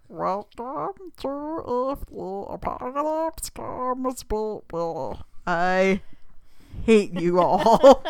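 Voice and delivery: slow, strained throat-noise creature; Yoshi voice. Shown as a demonstration. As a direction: gurgle it out like Yoshi. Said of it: "It's just a weird throat noise".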